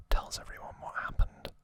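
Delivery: ordinary delivery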